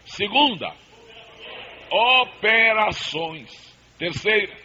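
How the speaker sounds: loud, drawn-out call to the crowd. Real, not acted. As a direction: call each word out strongly.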